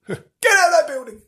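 high distant shout; shouting bloke. Retold or imitated. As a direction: replay the guard's yell from outside. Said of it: "I heard him".

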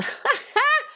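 sudden high giggle breaking out; high burst of laughter. Real, not acted. Host cracking up at her own accidental innuendo, giggling. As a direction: laugh at your own slip.

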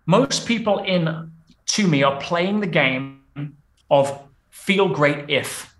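slow, spaced-out teaching delivery; deliberately slow. Shown as a demonstration. Coach spelling out a language pattern for listeners, deliberately slow. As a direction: say it slowly, pausing between phrases. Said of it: "So I'll say it slowly".

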